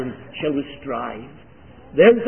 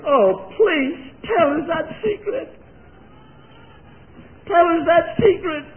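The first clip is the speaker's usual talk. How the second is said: high whining plea for the secret; mock congregant pleading, high and crying; 5 dB louder, slower than usual. Preacher mimics eager pleading congregation.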